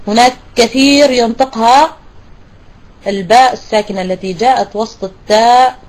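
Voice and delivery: ordinary talk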